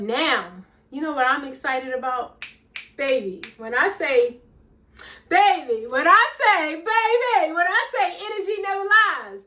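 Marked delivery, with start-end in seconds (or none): 3.0-9.4 building excitement. Real, not acted. Speaker fires up her listeners, building excitement. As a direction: preach it, climbing each time. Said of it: "building, preaching rise".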